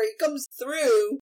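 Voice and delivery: ordinary talk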